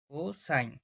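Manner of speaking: cosine said slowly in full, co-sine; full word cosine. Shown as a demonstration. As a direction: spell out cosine fully, not cos. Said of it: "সম্পূর্ণ উচ্চারণ করে cosine".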